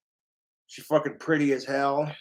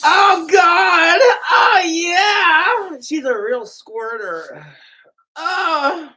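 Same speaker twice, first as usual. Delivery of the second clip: shrill, much higher screaming moans; mock orgasm cries; 13 semitones higher, 10 dB louder, much slower than usual. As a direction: ham up an over-the-top climax. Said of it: "that was not appropriate".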